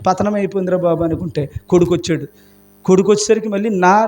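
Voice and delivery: usual speaking voice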